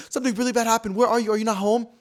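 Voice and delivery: high, loud urgent questioning; frantic caller impression, high and loud; alarmed mock panic. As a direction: press them urgently in an imitated panic. Acting out manager's alarmed phone call.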